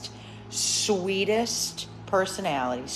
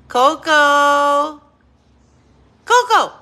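much higher and louder, long call; 7 semitones higher, 12 dB louder, slower than usual. Real, not acted. Owner calling her dog loudly from afar. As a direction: call the dog across the room.

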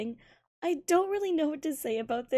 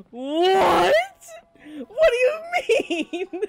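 high, loud disbelieving cry; hearing the lover slept with his father; shocked shout; 6 semitones higher, 9 dB louder, much slower than usual. Acted. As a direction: shriek in disbelief. Stunned reaction in skit.

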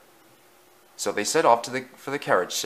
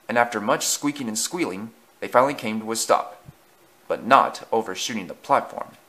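plain reading voice

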